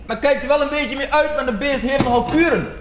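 high, lightly voiced neighbour lady; mock neighbour voice; neighbour woman. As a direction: answer sweetly as the older neighbour. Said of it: "Ze zei".

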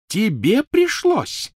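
very high, drawn-out outrage; incredulous, stretched squeal; rising shriek. Acted. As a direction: let indignation push the voice sky-high. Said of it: "Голос у Гермионы всё повышался".

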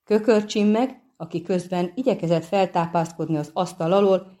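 plain reading voice

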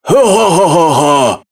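slow scornful laugh; mocking royal laugh. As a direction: laugh down at the poor boy. Sultan mocks poor suitor.

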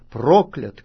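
loud, falling solemn word; loud proclamation. A narrator's reading. Levites pronouncing a curse to all Israelites, loud proclamation. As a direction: hit the curse firmly. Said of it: "скажут всем израильтянам громким голосом".

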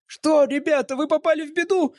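put-on high, excited boyish shout; Jimmy shouting. A narrator's reading. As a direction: call out breathless and high. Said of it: "крикнул он".

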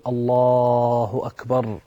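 long-drawn opening takbir; prayer takbir. Acted. Worshipper facing the qibla to open night prayer, long-drawn takbir. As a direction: draw out the words slowly, as if beginning prayer. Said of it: "واستقبل القبلة وقل".

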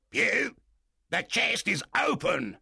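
high, loud call from a window; pirate shouting down. Acted. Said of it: "he told the blind man".

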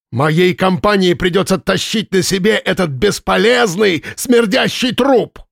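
high, slow, furious roar; angry shouting. A narrator's reading. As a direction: spit out смердящий труп slowly. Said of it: "коротко рыкнул".

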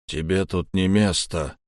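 usual speaking voice

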